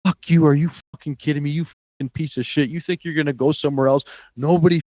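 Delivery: retold angry yell, pitched high; Mike Eaves yelling. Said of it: "He starts yelling at me".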